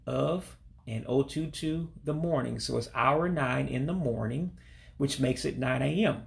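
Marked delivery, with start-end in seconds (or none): none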